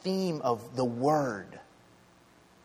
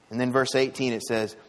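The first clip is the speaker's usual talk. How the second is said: normal talking voice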